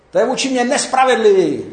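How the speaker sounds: high, indignant protest; crying out inside. Acted. Preacher voices a wronged worker's inner voice, crying out in protest. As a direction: protest loudly as the wronged one. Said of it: "To ve vás tak křičí".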